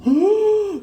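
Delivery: sharp high gasp of horror; shocked gasp. Retold or imitated. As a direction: gasp as if struck. Hearing alcohol is included.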